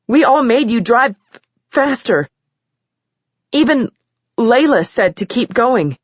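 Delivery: high, upset, near sobbing; tearful confession. A narrator's reading. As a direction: confess through tears.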